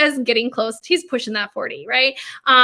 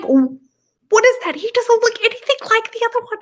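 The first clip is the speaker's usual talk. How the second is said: shocked dismay; 12 semitones higher than usual. Retold or imitated. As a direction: recoil at the wrong elf. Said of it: "and I was like".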